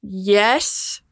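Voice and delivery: drawn-out, unsure yes; stall, then concede it slowly; hesitant answer. Real, not acted. Game master answering players' question about badges, hesitant.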